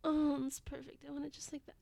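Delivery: moaning, sighing delivery, swelling on the exclamations; breathy arousal. Acted. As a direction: gasp and moan between breathy phrases. Acted intimate scene, aroused.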